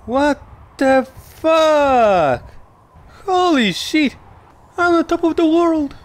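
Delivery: high, loud exclamations of shock; excited shouting. Real, not acted. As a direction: shout it out, amazed at the view. Reacts to huge height.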